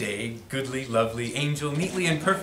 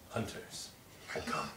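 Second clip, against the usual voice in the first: ominous whisper; 10 semitones lower, 19 dB quieter than usual. A narrator's reading. Ominous turn in story.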